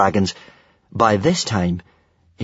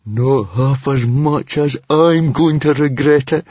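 slow, tense, higher-pitched grumble; pitched-up, strained complaint; through gritted teeth; 5 semitones higher, 3 dB louder, slower than usual. A narrator's reading. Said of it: "said Fishlegs between gritted teeth".

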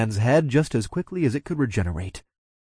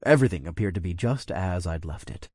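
usual speaking voice

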